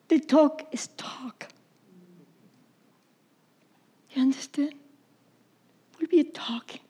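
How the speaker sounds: hushed unvoiced whisper. Real, not acted. Pastor whispers to the congregation, hushed.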